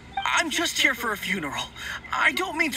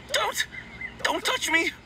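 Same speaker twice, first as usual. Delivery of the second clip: higher, panicked stammer; frightened plea; 9 semitones higher than usual. Acted. Timid undertaker backs away from strangers, frightened plea. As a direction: recoil and beg them off. Meng begs them to keep away.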